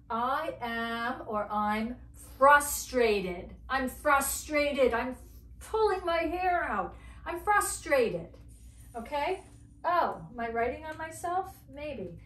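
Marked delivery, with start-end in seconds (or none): 1.5-4.9 loud, slow, emphatic exasperation; acted frustration. Shown as a demonstration. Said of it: "I'm very full of feeling today". 4.9-8.3 high-pitched exasperated outburst; acted frustration. Shown as a demonstration. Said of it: "I'm very full of feeling today".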